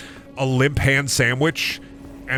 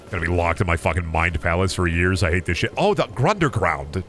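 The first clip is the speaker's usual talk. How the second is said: ordinary talk, nothing marked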